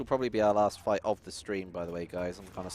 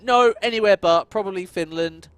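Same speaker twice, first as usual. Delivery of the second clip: higher and louder, mocking the quiz crowd; mock know-it-alls; 10 semitones higher, 15 dB louder than usual. Streamer mocking people who denied Sweden is biggest, high mocking voice. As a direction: squeal it like smug know-it-alls. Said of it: "They're like".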